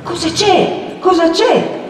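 high, urgent repeated question; alarmed questioning. A narrator's reading. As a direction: demand anxiously to know what happened. Startled Agnese questions fleeing Perpetua.

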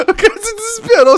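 high, loud laughing burst. Real, not acted. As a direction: crack up while talking. Laughing at panicked player.

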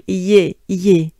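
"Ye" with both y and e hit loud; stress y and e. Shown as a demonstration. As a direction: punch both the y and the e in "Ye". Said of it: "accentuate the Y and the E".

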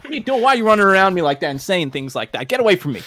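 loud, high, rattled complaint; alarmed protest. Acted. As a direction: demand frantically to be left alone. Protesting at being circled.

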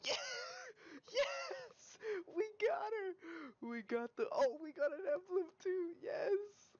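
very high, squealing victory cries; ecstatic screaming. Real, not acted. Gamer celebrating a kill and an emblem, ecstatic screaming. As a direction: shriek with joy, voice way up high.

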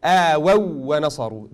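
آووا with doubled waw; correct recitation again. Shown as a demonstration. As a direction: stress the shadda on the waw of آووا. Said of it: "This emphasis I'm doing shows that this idgham".

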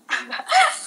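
sudden high burst of laughter; high squeal of laughter. Real, not acted. Laughs at husband's question.

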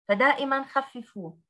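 normal talking voice

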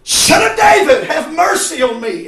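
sudden high, loud call; crying out. Acted. Blind men call to Jesus passing, crying out. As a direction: call out like a beggar desperate to be heard.

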